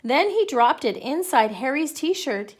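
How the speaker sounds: ordinary delivery, nothing marked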